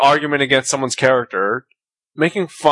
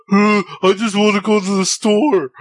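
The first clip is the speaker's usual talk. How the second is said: higher-pitched mocking dimwit voice; doofus voice; 7 semitones higher than usual. Telling how people mimic a guy they hate, doofus voice. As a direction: put on a dopey doofus voice to mock someone. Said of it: "doofus voice".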